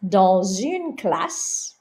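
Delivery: dans une classe very slow, dans linked as danz-une; slow French liaison demo. Shown as a demonstration. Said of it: "you would hear the S in liaison".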